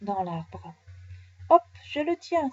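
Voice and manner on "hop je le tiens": high, loud triumphant hop; exclaiming. Acted. Nounours catches the bird by a leg, exclaiming. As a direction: cry out in triumph. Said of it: "s'exclame notre gros ami".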